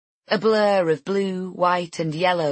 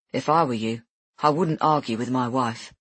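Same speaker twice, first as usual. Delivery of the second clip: a hint of a lower male voice; slightly lower, dry husband; lightly voiced husband; 5 semitones lower than usual. Husband Jack speaks.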